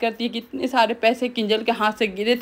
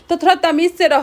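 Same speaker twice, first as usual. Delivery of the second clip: a mildly raised, higher scolding line; imitating a stern rebuke, somewhat louder; retold scolding; 6 semitones higher, 4 dB louder, faster than usual. The elder retelling how she scolded Mahi, mildly raised scolding. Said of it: "इस तरह से माही को डांट लगाती है".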